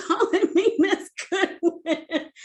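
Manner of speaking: words breaking up with laughter; laughing exasperation. Real, not acted. Storyteller recalls the name that vexed her soul, laughing. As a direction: laugh through the complaint.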